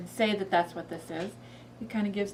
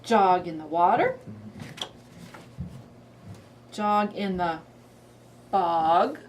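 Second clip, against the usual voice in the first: put-on reading to a toddler; 4 dB louder, slower than usual. Shown as a demonstration. Reads frog book.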